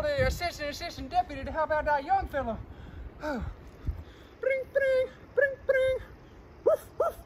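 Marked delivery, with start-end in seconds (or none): 4.4-6.0 high sing-song bring bring; phone ringing. Pretend. 6.6-7.2 high yappy woof woof; dog barking. Pretend. Lassie the dog barks.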